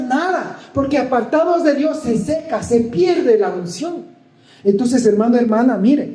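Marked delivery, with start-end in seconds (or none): 0.0-4.0 high, urgent pulpit preaching; fervent preaching. Real, not acted. Preacher warning the congregation against drifting from God, fervent preaching. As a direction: press each point home in a raised preaching voice. Preaches with raised pitch, rapid phrases.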